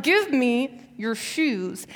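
drawn-out insistence. Retold or imitated. Insistent repeat to sister.

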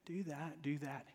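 soft, slow, coaxing mother; gentle mother. Retold or imitated. Mom to her stuck son, very gently. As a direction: imitate a mom gently talking her child through it. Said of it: "very gently".

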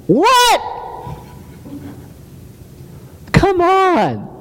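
high-pitched, drawn-out incredulous outburst; exaggerated, squeaky-high disbelief; mock disbelief. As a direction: react as if the claim is absurd, voice leaping up. Mock disbelief at the verse's claim.

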